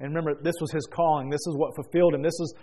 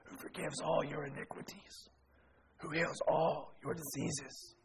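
slow, whispered psalm line; much softer, hushed reading; hushed scripture reading; 11 dB quieter, slower than usual. Retold or imitated. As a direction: read it softly, barely voiced. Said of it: "listen to this recording".

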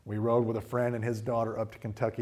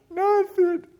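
very high, loud little voice; sheepish kid; 10 dB louder than usual. Retold or imitated. A daughter dodging her dad's question. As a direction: chirp it like a guilty child.

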